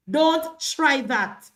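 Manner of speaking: each word spaced and pointed; slow warning. Real, not acted. To Sheldon, rejecting his claim, slow warning.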